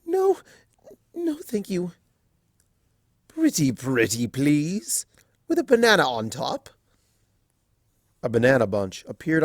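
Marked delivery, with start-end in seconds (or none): 0.0-1.9 very high startled squeak; shy mare squeaking no to her teasing friend. Acted. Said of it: "she squeaked". 3.3-6.7 lightly voiced mock begging; a hint of sing-song wheedling; pleading Discord.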